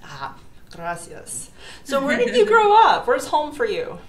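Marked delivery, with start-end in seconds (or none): none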